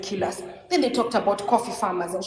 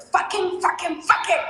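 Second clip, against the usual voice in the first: much higher, louder defiant cursing; furious outburst; 8 semitones higher, 3 dB louder than usual. Real, not acted. Threatened activist defying the president, furious shout. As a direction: spit it out in full rage.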